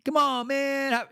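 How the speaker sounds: mock tempter egging on; pushy buddy. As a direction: needle them up high like a pushy friend. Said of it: "people around you say".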